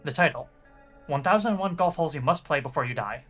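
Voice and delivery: ordinary talk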